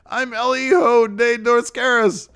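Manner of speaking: high mock brother voice insisting on his name; whiny brother. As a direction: squeak out the name in protest. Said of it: "And his brother's like".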